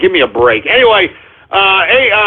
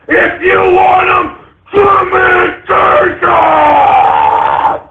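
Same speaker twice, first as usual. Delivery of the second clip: screaming near tears; 9 semitones higher, much slower than usual. Acted. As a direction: scream it till the voice cracks. Defiant rant peak.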